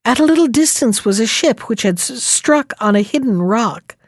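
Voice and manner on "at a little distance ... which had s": ordinary delivery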